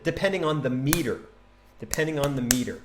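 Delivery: ordinary talk, nothing marked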